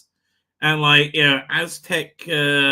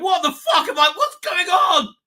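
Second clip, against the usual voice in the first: bewildered American viewer; 8 dB louder than usual. Retold or imitated. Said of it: "the Americans are going".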